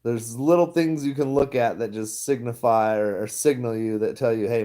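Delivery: normal talking voice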